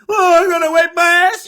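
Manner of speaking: mock whine. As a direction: moan about it like it's a huge chore.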